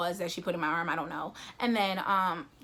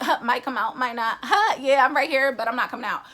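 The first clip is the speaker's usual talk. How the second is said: playful taunting voice, rising loud; teasing baby voice; 4 semitones higher, 8 dB louder than usual. Retold or imitated. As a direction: tease like a hiding baby, louder and higher. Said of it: "playing hide and go seek with them".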